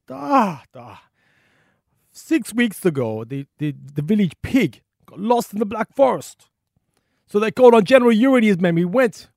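loud, high, hammed-up Russian accent; General Yuri. Old soldier telling a visitor the town's story, booming Russian accent. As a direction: bellow the tale like a comic Russian general. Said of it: "I'm terrible with accents".

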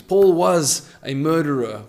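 normal talking voice

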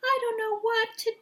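very high, slow fake crying whine; mock wailing. Mother mocking a contestant's tearful whining. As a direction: wail it like a whiny baby. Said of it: "That's how I wrote it down".

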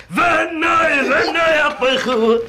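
retold shout. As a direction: yell it out. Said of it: "Ich schrei".

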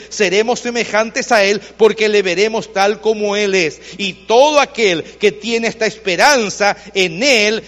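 loud, ringing pulpit voice reading scripture; full-force preaching. Acted. Pastor reading the epistle aloud to his church, full-force preaching. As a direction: preach it out to the whole congregation.

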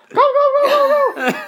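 very high, loud yelling go; high, loud, panicked run-away yell. Acted. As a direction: shriek it while running away. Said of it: "she turned around and yelled".

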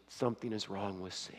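a frightened whisper. Retold or imitated. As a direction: breathe it out, barely voiced. Wife on phone, alarming news.

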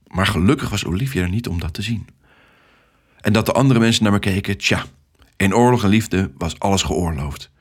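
ordinary delivery, nothing marked

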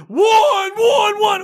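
very high mock coach scream; over-the-top exuberant coach. Imitating a sideline coach going for one, exuberant screaming. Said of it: "really over the top and exuberant going".

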